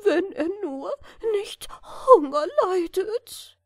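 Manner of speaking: high, tearful voice, close to crying; a worried mother weeping over her son; sobbing queen. Acted. Queen weeping over her departed son, high and tearful. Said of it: "Die Königin aber schluchzte".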